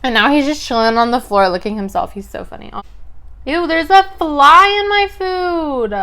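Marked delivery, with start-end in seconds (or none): 3.5-5.9 high, slow grossed-out ew; disgusted surprise. Real, not acted. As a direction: recoil at the fly. Finds a fly in food.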